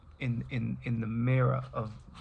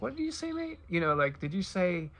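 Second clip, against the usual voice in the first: high, jokey Indian accent as a troubadour; silly Indian accent; 7 semitones higher than usual. Troubadour asking the Sufi mystic, in a silly Indian accent. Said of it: "I'm doing a silly Indian accent".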